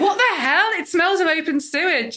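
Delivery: high-pitched mock outrage; acted appalled reaction. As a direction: play up the appalled disbelief, pitched well up. Acting out someone's appalled reaction.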